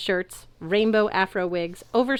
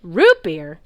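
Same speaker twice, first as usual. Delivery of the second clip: loud excited root beer; light up at the word root beer; loud gleeful question; eager; 10 dB louder than usual. A narrator's reading. Centaurs hear of root beer.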